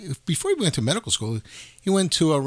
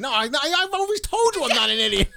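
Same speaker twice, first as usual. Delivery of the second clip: higher, indignant retort; exasperated protest; 11 semitones higher than usual. Retold or imitated. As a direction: insist you were never stupid, pitch climbing. Retold reply to her father.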